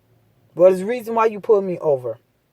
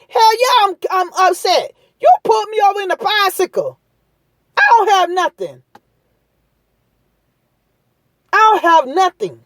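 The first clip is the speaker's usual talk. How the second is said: mock upset young man; 9 semitones higher than usual. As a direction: play the stopped cyclist pushing back hard. Acts what the young man should say.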